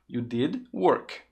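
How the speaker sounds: "You did... work", each word set apart; slow corrective model. Shown as a demonstration. Teacher corrects "did worked" for learners, slow and separated. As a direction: say "work" slowly on its own, with no ending.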